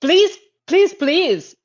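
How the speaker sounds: high, begging please; pleading. Real, not acted. Cohost begging Tom Holland not to trust fans, high plea.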